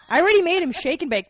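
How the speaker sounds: loud, high mock indignation; indignant protest. Wife defends herself about tonight, loudly indignant. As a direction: protest loudly that enough was done.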